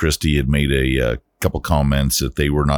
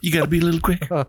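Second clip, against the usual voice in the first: commercial voice; 12 semitones higher, faster than usual. Retold or imitated. Imitating a remembered commercial.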